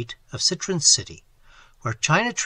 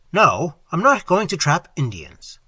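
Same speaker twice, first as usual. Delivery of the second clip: sharp, louder retort; 3 semitones higher, 7 dB louder than usual. A narrator's reading. Employer sharply denying her joke, louder. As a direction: snap back with a hard NOT. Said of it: "I said sharply".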